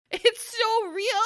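high, slow laughing line. Real, not acted. Admitting her rage over the cat to her co-host, laughing. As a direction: laugh through it.

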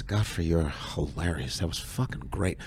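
low, soft Goldblum voice; Jeff Goldblum impression. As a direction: murmur it like Goldblum. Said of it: "and he goes".

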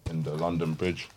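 normal talking voice

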